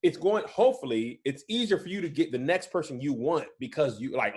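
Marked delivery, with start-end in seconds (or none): none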